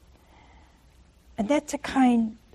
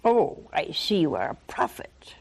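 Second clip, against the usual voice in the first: sly unvoiced whispered sass; smart aleck; 5 semitones lower than usual. Woman sidesteps Jesus with a whispered smart-aleck quip. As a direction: whisper it like a wisecrack. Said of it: "she gets a little smart aleck".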